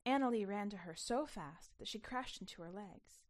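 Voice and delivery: usual speaking voice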